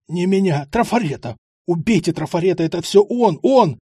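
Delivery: building from pleading to a loud high shriek; terrified pleading. Acted. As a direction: beg for your life, rising into a scream. Бандит в ужасе выдаёт сообщника. (испуганная мольба, переходящая в крик)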